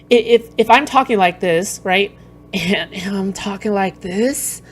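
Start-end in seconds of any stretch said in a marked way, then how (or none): 2.5-4.6 slow, put-on gravelly voice. Shown as a demonstration. Streamer mocking a man's fake husky mic voice, gravelly. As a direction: make it gravelly and ashy, drawn out. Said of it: "That sounds so gravelly and cool".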